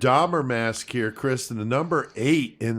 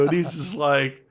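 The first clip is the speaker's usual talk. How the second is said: ordinary talk, nothing marked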